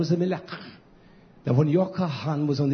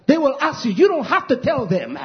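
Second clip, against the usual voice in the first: high, loud sermon shout; fiery pulpit cry, stretched key words; full-force preaching; 11 semitones higher, 6 dB louder than usual. Real, not acted. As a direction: drive every phrase home at a shout.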